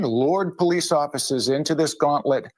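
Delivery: ordinary delivery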